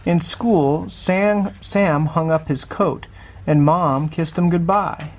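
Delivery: ordinary delivery, nothing marked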